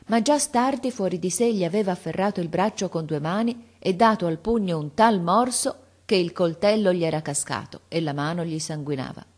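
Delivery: usual speaking voice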